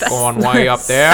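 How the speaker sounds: put-on slow Maine accent. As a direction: drawl it like a Mainer. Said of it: "that's my, like, Maine accent".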